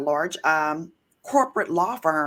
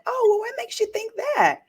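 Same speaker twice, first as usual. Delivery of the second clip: sweetly pointed; faster than usual. Retold or imitated. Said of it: "I was like".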